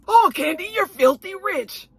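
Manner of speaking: high, sugary fake-polite question; mock shady sweetness. Marlo digs at Kenya's schooling in a shady high voice. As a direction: needle her with fake sweetness. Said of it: "trying to be shady and mean".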